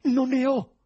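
high, loud, desperate cry; desperate shout. A narrator's reading. President swears innocence to investigators, desperate shouting. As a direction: shout your innocence in despair. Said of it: "gridò disperato il presidente".

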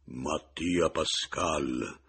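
very slow, booming sepulchral voice; hollow the voice out and stretch every syllable; cavernous voice. A narrator's reading. Man repeating his name to scare the widow, cavernous. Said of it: "incavernando ancor più la voce".

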